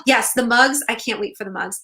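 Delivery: ordinary delivery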